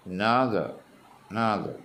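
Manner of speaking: "another" with a strong middle syllable and a fall after; stress on second syllable. Shown as a demonstration. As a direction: press on "noth" in "another", keep "a" and "er" small. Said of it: "രണ്ടാം syllable". ("another": രണ്ടാം syllable-ൽ ഊന്നി)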